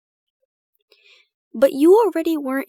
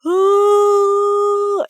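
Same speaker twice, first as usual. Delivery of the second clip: higher, louder mock open-mouthed cry; mock scream; 9 semitones higher, 7 dB louder than usual. Roommate acts out the Screaming Man painting, a loud mock scream. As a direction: imitate the painting's frozen scream. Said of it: "someone going".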